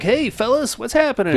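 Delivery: bright, high, breezy American greeting; cheery American voice. Retold or imitated. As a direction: greet the fellas like a chipper Yank. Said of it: "the American is like".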